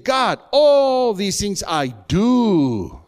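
a slow, drawn-out boast that all has been done; protesting rich young man. Acted. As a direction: drag out the proud claim of the rich young man. Rich man's reply, acted.

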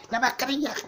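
MASCARILLA jumps up high in pitch; high-pitched nagging repeat; insistent reminder. Pretend. Third, exasperated call for mask.

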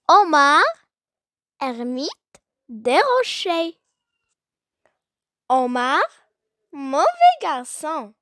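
measured classroom poem reading, punching each name; poem recitation. Acted. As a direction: recite it line by line with strong melodic swings and long pauses.

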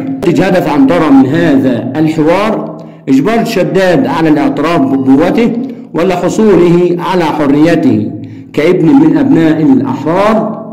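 usual speaking voice

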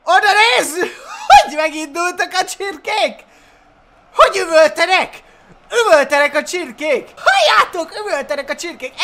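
high, loud, laughing exclamations; amused excitement. Real, not acted. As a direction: shout in delight through laughter. Amazed at screaming charging chickens.

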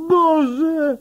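jeszcze wyższy, piskliwy wrzask grozy; przeciągnięty pisk przerażenia; panika na szczycie. A narrator's reading. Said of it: "wrzeszczy gliniarz". (policjant w panice ucieka przed świnią)

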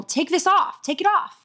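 high panicked yell; frantic scream. Acted. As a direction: lose control and beg. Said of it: "she screamed".

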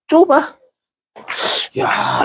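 ordinary talk

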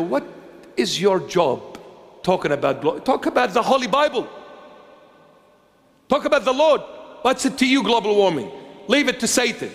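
ordinary talk, nothing marked

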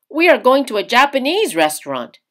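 put-on young man caught off guard; father asking about the kimono, surprised. A narrator's reading. Father's voice in story.

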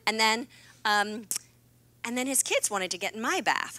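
usual speaking voice

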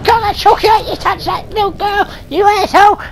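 high, shouty rage; high angry yelling. Acted. Ghost Doors attacks, raging.

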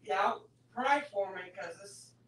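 ordinary talk, nothing marked

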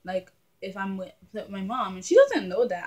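normal talking voice

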